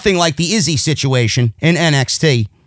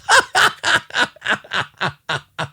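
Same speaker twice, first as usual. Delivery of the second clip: derisive laughter; 4 dB quieter than usual. Real, not acted. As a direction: burst out laughing at the absurd idea. Laughs at the wrestler's idea.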